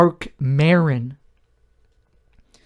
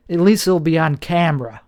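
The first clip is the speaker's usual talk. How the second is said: ordinary talk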